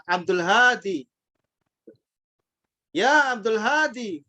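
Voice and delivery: Abdul Hadi with a long a in Hadi, slow. Retold or imitated. Teacher imitates Arabs saying Abdul Hadi, slowly. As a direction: draw out the a in Hadi.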